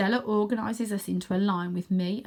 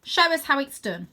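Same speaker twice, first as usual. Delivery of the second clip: put-on higher, louder bossy girl; bossy challenge; 6 semitones higher, 5 dB louder than usual. Stella challenging the boys to show them, bossy. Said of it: "says Stella, hands on hips".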